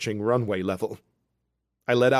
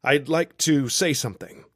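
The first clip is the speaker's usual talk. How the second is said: announcing; 5 semitones higher, 5 dB louder than usual. A narrator's reading. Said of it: "when I announce".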